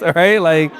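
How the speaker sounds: high and loud, playful; bright playful emphasis; excited. Real, not acted. Joking about giving candy.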